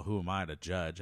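ordinary delivery

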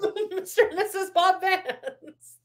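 high, giggly wedding announcer; laughing mock announcement. Podcaster parodying the wedding officiant, laughing announcement. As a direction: announce it while cracking up, voice climbing.

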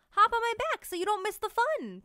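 high, loud eager offer; eager porcupine. Acted. Pickles offering Tank a ride, high and eager.

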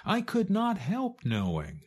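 high, small chick voice; a little chick insisting, pitched high; Chicken Little. A narrator's reading. Chick tells the fox what it saw, high and insistent.

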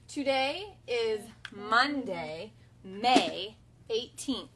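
slow, deliberate, each word spaced; slow model reading. Shown as a demonstration. Teacher modeling the message line slowly. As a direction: read it like a model for kids. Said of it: "Your turn".

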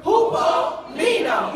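high, loud, drawn-out "Hupomeno"; rousing call-and-response. Shown as a demonstration. Last group try, called out bigger.

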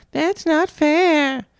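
high, very slow squeal; squealed mockery. A narrator's reading. Vice principal mocking Violet with a high squeal. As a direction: squeal it back like a mocking brat. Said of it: "the vice principal squealed back".

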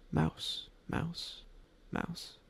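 very soft, hushed repetitions; talking to himself. A narrator's reading. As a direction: murmur each word under your breath, spaced apart. Robert mumbles to himself in story.

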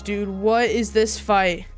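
usual speaking voice

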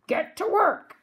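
loud, high bark; pirate command. Acted. Captain Bones sets Pinky and Stinky digging, barking. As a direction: snap the order out at the crew.

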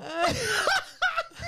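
high shrieking laugh, nearly crying; helpless cackling laugh at the memory; burst of laughter. Real, not acted. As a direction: laugh until you can't breathe. Hysterical laughter recalling prank.

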